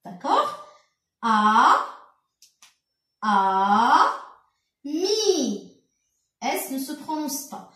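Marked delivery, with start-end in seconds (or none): 1.2-5.8 "A... a, mi" drawn out for beginners; sounding out syllables. Shown as a demonstration. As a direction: hold "A" and "mi" apart with pauses. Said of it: "on va lire tout ça".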